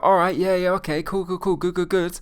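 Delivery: high, overeager string of approval; hammed-up excited acknowledgement; fanboy excitement. Shown as a demonstration. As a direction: gush like an impressed fanboy. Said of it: "like, a little fanboy about money".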